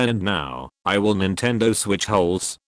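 ordinary talk

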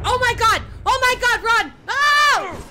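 loud high frantic yells; screaming at the run; panicked shouting. Real, not acted. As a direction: flee in real terror. Chased by attacker.

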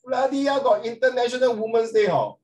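loud, high, hammed-up grievance; mock outrage. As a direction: play up fake indignation for the class. Teacher jokingly complains, no Men's Day.

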